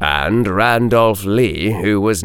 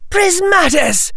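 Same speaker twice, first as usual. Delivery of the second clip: sharp, high-pitched incantation; urgent spell-casting. Acted. Susan shields against the hex, urgent incantation. Defensive spell cast in fight.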